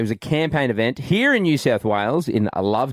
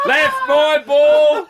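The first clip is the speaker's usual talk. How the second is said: high, loud, drawn-out mock singing yell; hammed-up tuneless bellow; 7 dB louder, much slower than usual. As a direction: holler a mangled lyric in imitation. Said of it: "She started yelling".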